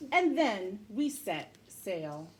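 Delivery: plain reading voice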